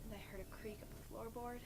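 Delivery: low, soft, sombre narration; hushed horror storytelling. Acted. Storyteller recounting the officer's news and night alone, hushed and grim. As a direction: sink into a grave hush with long pauses.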